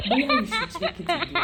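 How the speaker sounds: laughing plea. Retold or imitated. Pleading with the worker, laughing.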